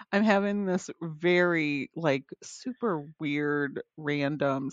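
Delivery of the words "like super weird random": ordinary talk, nothing marked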